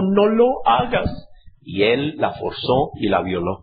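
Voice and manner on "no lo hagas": higher, slow, lightly begging; a hint of a tearful plea; mild mock pleading cry. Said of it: "le gritó, le lloró".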